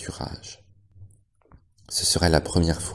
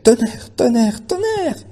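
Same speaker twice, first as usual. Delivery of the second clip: high piercing call; desperate cry; 7 dB louder, slower than usual. A narrator's reading. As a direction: scream his name after the van. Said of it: "cria-t-elle".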